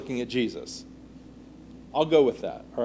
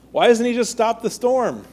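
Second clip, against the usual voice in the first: higher-pitched, mildly exasperated wondering; puzzled complaint; 8 semitones higher, 3 dB louder than usual. Acted. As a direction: voice their baffled thought aloud. Voicing the puzzled disciples' thoughts.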